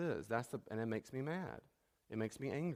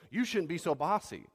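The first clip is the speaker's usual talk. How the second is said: scolding; 5 semitones higher, 6 dB louder than usual. Retold or imitated. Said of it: "I don't go".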